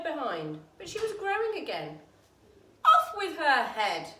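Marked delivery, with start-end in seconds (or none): 2.8-4.1 loud, slow furious decree; shouting queen. A narrator's reading. Queen shouting her sentence on Alice, loud and slow. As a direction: bellow the sentence. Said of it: "shouted the Queen".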